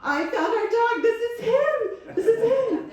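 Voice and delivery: high-pitched, drawn-out yell of discovery; husband's elated yell, acted out; excited yelling. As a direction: call out excitedly to someone in another room. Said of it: "I heard my husband yelling from the other room".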